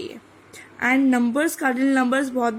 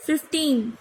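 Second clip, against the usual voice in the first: ordinary talk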